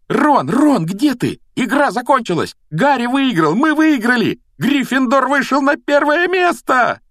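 high-pitched, jubilant yelling; joyful screaming. A narrator's reading. Hermione screams to Ron that Gryffindor won, joyful. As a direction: shout the victory, bursting with joy. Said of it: "радостно вопила Гермиона".

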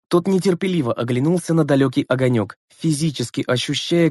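usual speaking voice

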